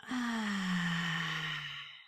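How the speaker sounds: long falling open-mouthed exhale; deep releasing sigh. Shown as a demonstration. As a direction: sigh out one full, deep breath. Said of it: "Last deep breath".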